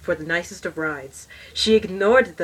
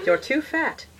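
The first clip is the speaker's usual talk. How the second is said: sneering repeat; 9 semitones higher than usual. Pretend. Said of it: "Say that?".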